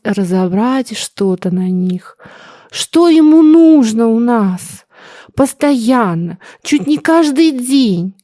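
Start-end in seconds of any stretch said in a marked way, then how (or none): 2.7-8.1 higher and louder, slow; exasperated mother. Acted. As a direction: press the question hard. Mother insists. (раздражённо, громко и медленно)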